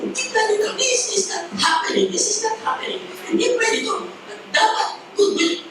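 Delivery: high, breathy frantic protest; outraged disbelief. Acted. Wife seeing her husband's mistress get double, high frantic protest.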